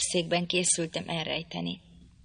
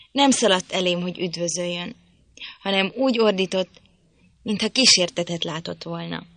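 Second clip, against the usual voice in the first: ordinary delivery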